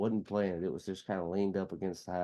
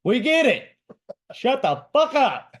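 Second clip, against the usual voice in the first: high, loud exasperated shout; fed-up audience member hollering; yelled heckle; 11 dB louder than usual. Retold or imitated. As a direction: yell it out over the crowd. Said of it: "yelled out, he's like".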